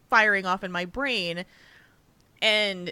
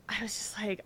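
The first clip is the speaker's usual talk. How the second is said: whisper; 10 dB quieter than usual. Real, not acted. Whispered aside.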